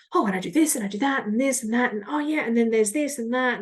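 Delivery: fast, jumpy rambling on one breath; scattered rambling. Shown as a demonstration. Demoing the overwhelmed tone to listeners, hurried. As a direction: rattle it off, all over the place. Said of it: "as opposed to perhaps a bit like".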